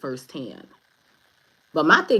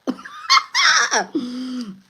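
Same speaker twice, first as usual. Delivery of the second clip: high, loud, gleeful cackle; real laugh; 13 semitones higher, 7 dB louder than usual. Real, not acted. Laughing at God's mighty swipe, high loud cackle. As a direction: burst out cackling.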